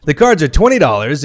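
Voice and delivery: ordinary delivery, nothing marked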